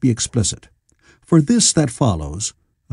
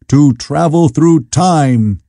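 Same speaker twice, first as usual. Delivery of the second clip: slow, drawn-out and a bit louder; eager exclamation; 3 semitones higher, 4 dB louder, much slower than usual. A narrator's reading. Very young man exclaiming to the time traveler, slow and eager. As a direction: stretch it out in wonder. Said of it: "exclaimed the very young man".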